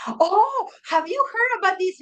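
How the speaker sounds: high, eager gossiping friend. Retold or imitated.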